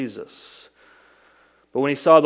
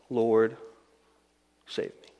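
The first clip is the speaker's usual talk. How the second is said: hushed plea; 4 semitones lower, 5 dB quieter, slower than usual. Acted. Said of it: "And he cried out".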